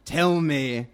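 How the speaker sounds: low rumbling duck ruler. Acted. Red Duck questioning the bat, rumbling. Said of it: "her voice rumbled".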